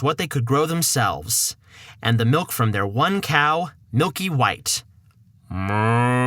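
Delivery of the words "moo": cow moo. Acted. Cow lows in story.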